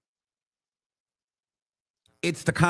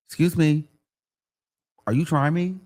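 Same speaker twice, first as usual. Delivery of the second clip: mock affronted reply. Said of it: "look at you like".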